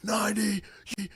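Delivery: put-on high, thrilled kid-like cheer; excited kid. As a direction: celebrate like a little kid. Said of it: "he's excited".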